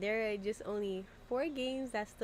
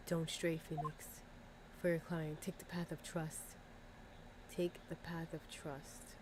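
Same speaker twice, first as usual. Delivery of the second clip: softer, lower, calm guiding voice; hushed mentor voice; 6 semitones lower, 12 dB quieter than usual. Acted. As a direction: speak like a gentle ghostly mentor. Voices Mia's spirit message.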